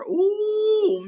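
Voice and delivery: shocked ooh. Real, not acted. Host recalling the baby scandal, high shocked ooh. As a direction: gasp at the gossip.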